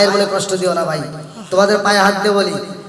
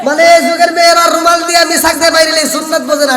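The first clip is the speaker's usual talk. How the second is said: rousing shout; 7 semitones higher, 5 dB louder than usual. Real, not acted. Preacher rousing the crowd.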